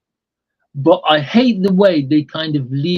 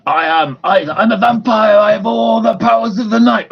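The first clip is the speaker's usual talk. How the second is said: vampire boast; 7 semitones higher, 3 dB louder than usual. Retold or imitated. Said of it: "it's like".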